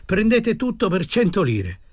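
high-pitched, insistent sales pitch; eager pleading salesman. Acted. Desperate man selling his goods to an old woman, eagerly pleading. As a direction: list the goods eagerly, voice lifted.

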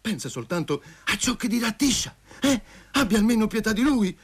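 anguished plea climbing high in pitch; desperate pleading. A narrator's reading. Lover begs Masha to pity Tisha, desperately.

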